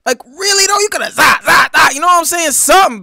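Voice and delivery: high-pitched, loud disbelief; worked up, voice way up high; incredulous outburst. Real, not acted. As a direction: react like you can't believe the blunder. Stunned by the straight-line run.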